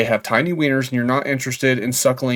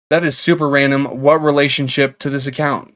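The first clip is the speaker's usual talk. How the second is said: ordinary talk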